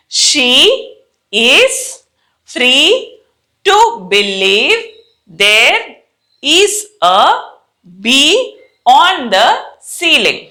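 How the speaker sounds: word by word with long pauses; slow model reading. Shown as a demonstration. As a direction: read it slowly for students to copy. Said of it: "తర్వాత నాతో పాటు చదవండి".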